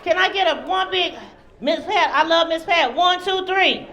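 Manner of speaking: loud, high-pitched call to the audience; rally the room into a big cheer; hyping the crowd. Real, not acted.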